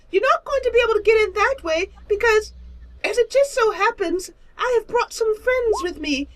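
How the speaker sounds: lofty squeaky creature; prissy high monster drawling. Acted. Creature threatens the party.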